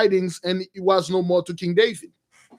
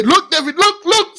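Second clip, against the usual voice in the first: higher, loud, eager calling; eager urging; 9 semitones higher, 4 dB louder than usual. Retold or imitated. The brothers urging David to look, loud eager calling. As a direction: push the prize at him, pleased with yourself.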